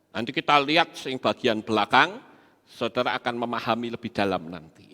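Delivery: ordinary talk, nothing marked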